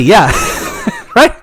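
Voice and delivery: ordinary delivery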